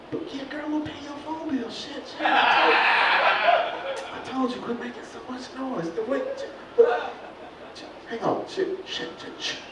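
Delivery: hushed panicky whisper; whisper, scared of waking mama. Acted. Whispering, mother nearby.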